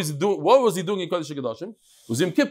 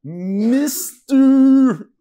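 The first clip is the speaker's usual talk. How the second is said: high, drawn-out pleading whine climbing higher; mock whining at the president; whiny begging; 8 semitones higher, 4 dB louder, much slower than usual. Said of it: "you talk like that".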